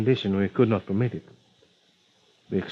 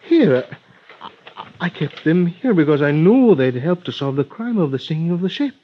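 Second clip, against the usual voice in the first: put-on rising, louder Spanish-accented alarm; 6 semitones higher, 4 dB louder than usual. A Spanish-accented doctor discovering his notes stolen, alarmed. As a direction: blurt it out in a Spanish accent as panic rises.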